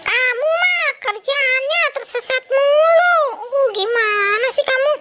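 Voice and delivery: high-pitched nagging puppet; exasperated scolding. Acted. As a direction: scold the lost friend in a squeaky high voice. Scolding lost friend, high pitch.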